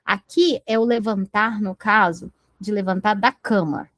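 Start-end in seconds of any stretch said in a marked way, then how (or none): none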